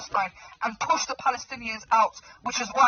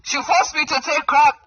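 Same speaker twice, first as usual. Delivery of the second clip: loud, slow mock accusation; mock dramatic shout; 6 semitones higher, 7 dB louder than usual. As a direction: drag it out loudly, hamming. Repeated dramatic claim, loud slow.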